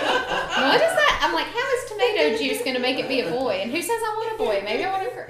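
high-pitched mock protest; retold indignant disbelief. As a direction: act out baffled objection, pitch leaping up. Acting out indignant reply to grandma.

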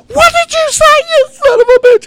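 high, loud furious screech; angry blonde gal. Retold or imitated. Angry gal screeching at the man in high fury. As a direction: shriek at him in a high rage. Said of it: "she goes ape shit pissed off".

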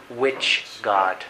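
low, soft, mocking demon question; lightly voiced demon taunt; demon retort. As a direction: sneer it quietly as the demon. Demon answers the preacher.

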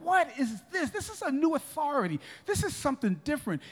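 astonished. Acted. Astonished crowd reacting to Jesus' new authority, wonder. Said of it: "they were astonished".

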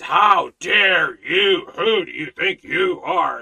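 slow, loud, indignant How dare you; mock arrogant shout. Preacher mimics an arrogant teacher rebuking him, shouting. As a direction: roar it as the offended preacher. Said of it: "anybody who dares bring up".

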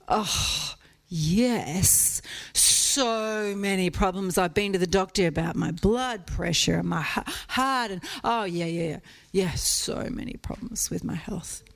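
a hint of a weary woman listing her ailments; lady asking for prayer. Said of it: "This lady came up".

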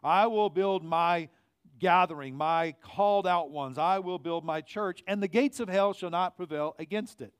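full-voiced sermon proclamation; preaching proclamation. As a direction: preach it out to the whole room.

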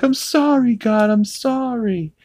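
high, mock-desperate begging; high tearful-sounding plea; pleading Peter. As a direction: beg God for his son, hammed-up. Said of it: "he's like".

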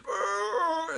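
high smothered moan; mock muffled wail. Old man fighting the pillow, mock muffled wail.

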